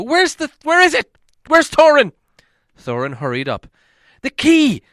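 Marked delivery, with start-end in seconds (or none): 0.0-2.1 much higher, much louder frantic search; frantic cry. Acted. Bilbo yelling for Thorin, frantic and urgent. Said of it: "cried Bilbo". 4.2-4.8 much higher, much louder shout. Acted. Bilbo shouting at Thorin to use the key, desperate. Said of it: "shouted Bilbo".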